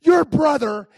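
high, loud retold complaint; going off on her. Husband complaining to his wife about her brother, going off on her. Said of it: "I'm just going off on her".

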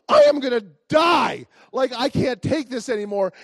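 high, loud hammed-up despair; exasperated shout. Runner going back to her post again, exasperated shout. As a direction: cry out in mock agony at the end of your rope. Retold exhausted outburst while running.

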